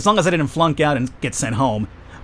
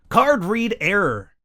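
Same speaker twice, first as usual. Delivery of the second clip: very slow, higher-pitched machine announcement; card machine voice; 6 semitones higher, much slower than usual. Acted. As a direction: stretch each word like an automated error. Voicing the card machine's message.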